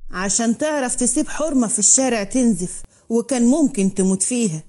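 usual speaking voice

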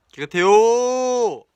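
long, high, loud stretched whine; drawn-out whine. Pretend. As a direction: drag the word out like a sulky child. Insisting room is clean.

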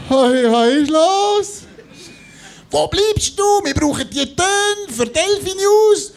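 high, slow, exasperated colleague; drawn-out what's-going-on; agitated colleague. Retold or imitated. Angry call from the station.

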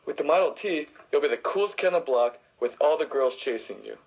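ordinary talk

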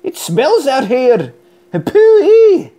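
hammed-up disgust; disgusted hairdresser. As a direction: recoil at the stink. Hairdresser smelling Hamish's coat.

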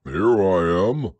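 slow, loud bear growl; growly voice. A narrator's reading. Pooh answering from behind Christopher Robin, growly. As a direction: answer with a big, drawn-out growl. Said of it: "said a growly voice behind him".

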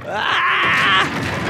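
long, high-pitched yell; high scream. Real, not acted. Player mid-celebration as things go wrong, high scream.

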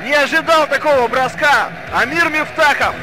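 ordinary talk

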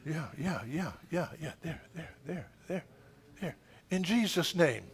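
ordinary talk

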